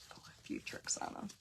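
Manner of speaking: unvoiced conspiratorial whisper. Real, not acted. Sharing a sneaky tip with viewers in a whisper.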